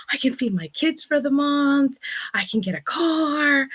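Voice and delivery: giddy relief spilling out; overjoyed disbelief. Retold or imitated.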